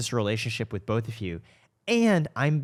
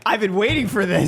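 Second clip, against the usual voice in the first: higher, louder mock-eager voice; eager guest; 9 semitones higher, 7 dB louder than usual. Host voicing the guest's eagerness, high and loud. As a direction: play up his excitement. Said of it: "Jeremy's like".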